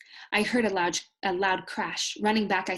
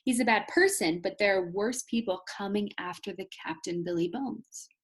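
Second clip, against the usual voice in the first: plain reading voice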